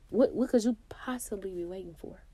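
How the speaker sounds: whispered question; whispered challenge to the listener; soft whisper. Real, not acted. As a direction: drop to a whisper. Rhetorical urging question.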